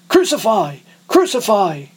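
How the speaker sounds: high, loud crowd cry; frenzied shout. A narrator's reading. Crowd of priests to Pilate, shouting in a frenzy. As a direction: hurl it like a mob in a frenzy. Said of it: "they shouted in a frenzy".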